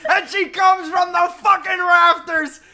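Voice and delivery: high, loud excited shout. Real, not acted. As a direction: yell it out in glee. Excited praise for the accent.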